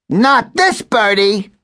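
high, slow warbling bird voice; warbled. A narrator's reading. Gabby declines the puppy's game, high warble. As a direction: sing it out like a bird. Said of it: "Gabby warbled".

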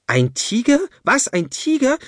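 high, shrill-pitched frightened squeal; an old woman shrieking in alarm. A narrator's reading. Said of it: "kreischte die Oma".

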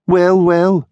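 high, drawn-out mocking greeting; sneering. A narrator's reading. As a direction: sneer it with false delight. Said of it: "he said in a sneering voice".

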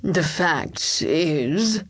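very slow, drawn-out reluctant opening; agitated hesitation. A narrator's reading. Chancellor reluctantly explaining to the king, agitated and halting. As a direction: drag it out uneasily. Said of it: "twisting his red beard in an agitated way".

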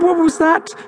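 high, startled hamster voice; frightened cry. Acted. Harold startled by a sudden squawk, high frightened cry. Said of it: "cried Harold".

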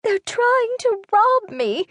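put-on high, slow, desperate elderly voice; an old lady begging not to be robbed; pleading old woman. A narrator's reading.

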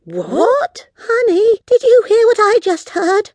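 very high, loud disbelief; astonished. Acted. Girl asking her friend about the talking dog, astonished. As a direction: gasp your question in amazement. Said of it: "looked at one another in astonishment".

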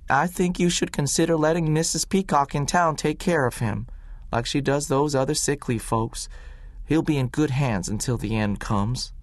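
doctor to boy, lightly voiced, coaxing; Doc Smith, persuasive. A narrator's reading. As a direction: coax the boy toward sense. Doc Smith advises Willie.

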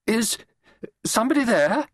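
sharp, high-pitched challenge. A narrator's reading. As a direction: call out sharply to an unknown presence. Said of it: "the woman spoke sharply".

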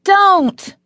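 sudden high angry shout; yelling father. A narrator's reading. Father yelling at his kids in the next car, loud angry shout. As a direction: bark it at the kids, full force. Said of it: "a father is yelling at his kids".

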